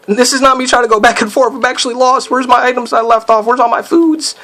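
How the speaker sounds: high, worried rambling; flustered gamer in a real panic; panicking. Real, not acted. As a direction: voice climbs as you realise you're lost. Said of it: "I was panicking them for the second".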